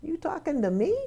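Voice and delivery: quoted challenge, high rise on the last word; imitated tough-guy challenge, questioning lift; Robert De Niro. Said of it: "does Robert De Niro say".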